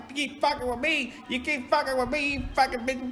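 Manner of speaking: high-pitched mocking imitation of a rant; hammed-up, squeaky tough talk; mock angry caller. Mocks Chris's angry voice message.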